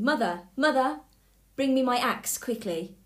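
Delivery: loud, high urgent shout. A narrator's reading. Jack shouts to his mother for the axe, urgent. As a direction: yell for help while fleeing.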